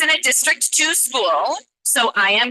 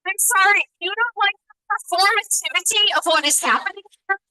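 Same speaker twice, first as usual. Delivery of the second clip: higher, heated and confronting; real anger; 4 semitones higher than usual. Real, not acted. Parent confronting the board members, really angry. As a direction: demand they pay attention, angry and pointed. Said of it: "forgive me for yelling at you, but I am angry".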